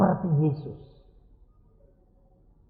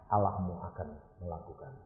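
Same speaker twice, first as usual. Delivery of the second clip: ordinary talk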